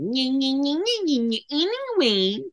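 put-on high nasal-free childish taunt; whiny mocking voice. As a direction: taunt like a bratty kid. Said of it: "I was reading those in my head like".

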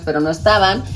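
ordinary talk, nothing marked

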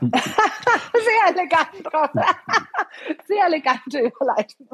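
high, drawn-out amused laughter through the words; helpless giggly praise; laughing. Real, not acted. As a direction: crack up while teasing. Laughing at host's awkward segue.

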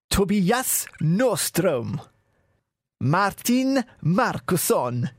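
slow Swedish lilt, swooping high on each name; sing-song Swedish. Pretend. Host crediting Swedish listeners, slow sing-song Swedish accent.

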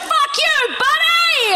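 high, drawn-out yell at another driver; mock road-rage yell. Yelling at the driver who cut her off, high drawn-out road-rage yell. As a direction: shout it out the window like road rage. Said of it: "I go".

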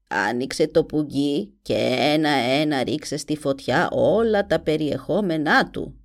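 put-on low, grim old witch. A narrator's reading. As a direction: croak it like a sinister witch. (φωνή μάγισσας)

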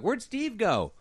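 high-pitched mock worry; concerned. Onlooker in the booth wondering about an exit, concerned. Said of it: "looking all concerned in the booth".

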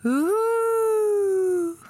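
high, long hooting wail; a wailing loon call that sounds like a ghost; ghostly loon call. Shown as a demonstration. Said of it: "That sounded a bit like a ghost".

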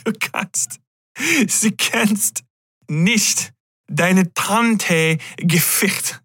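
halting, high, slow German with a laugh under it; broken German. Pretend. Joking to viewers in clumsy German, high and slow. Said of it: "I can't remember how to say fucked right".

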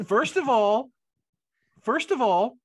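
high, loud indignant protest. Real, not acted. Player defending himself after being blamed, high loud indignant. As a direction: protest your innocence, rising.

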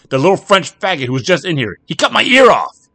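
very high, loud enraged shout; a man demanding the one who maimed him; furious accusation. Acted. Jack enraged at bartender.

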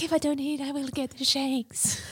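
mock crack addict. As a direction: tease her by playing a strung-out junkie. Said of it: "It sounds like a crack addict".

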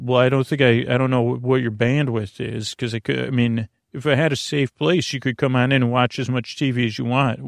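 usual speaking voice

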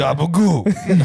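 slow, loud, high "Gabagool" stretched out; New Jersey Italian accent. Pretend. Trading mob impressions with friends, New Jersey Italian accent. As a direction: savor "Gabagool" like a Jersey mobster.

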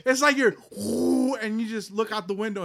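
high, loud, breathless with wonder; excited storytelling. Real, not acted. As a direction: paint the scene, thrilled and laughing. Acting out the scene in space.

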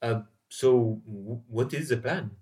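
a nervous traveller murmuring a question; hushed aside. Acted. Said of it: "trying to stay out of earshot".

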